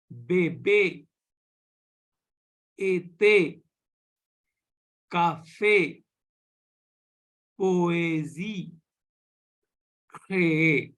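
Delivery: Bébé, Été, Café, Poésie, Créer with pure "ay" for é; French é pronunciation. Shown as a demonstration. Teacher models example words, Bébé to Créer with clear French é. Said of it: "it will be pronounced as".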